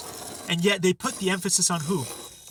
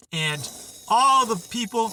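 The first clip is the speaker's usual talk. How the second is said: ordinary talk, nothing marked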